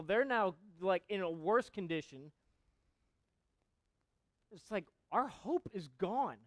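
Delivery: loud, slow, pounding sermon delivery; full-force preaching. Acted. Preacher thundering Ezekiel's prophecy to his congregation, loud and slow. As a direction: preach it to the back row.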